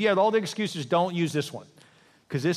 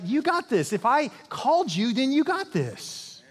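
encouraging God; 7 semitones higher, slower than usual. Acted.

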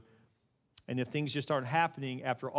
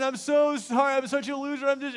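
high, loud, mock pleading; self-berating apologizer, pitched way up; overdone apology; 9 semitones higher, 5 dB louder than usual. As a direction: gush a hammed-up apology. Acting out a desperate apologizer.

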